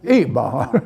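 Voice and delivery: "Eat" punched loud, "Baha" let go. Shown as a demonstration. Teacher demonstrating the accent, loud stress on "Eat". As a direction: hit "Eat" hard, then relax on "Baha". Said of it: "Just say it louder".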